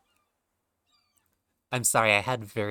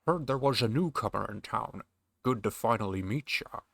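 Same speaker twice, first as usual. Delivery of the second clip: put-on Scottish old sailor; gruff sailor with Connery lilt; Sean Connery voice. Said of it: "this guy's gonna be Sean Connery".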